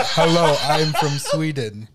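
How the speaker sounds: hammed-up Swedish accent for laughs; mock Swedish accent. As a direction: play a Swede introducing himself slowly. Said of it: "Aaron's like".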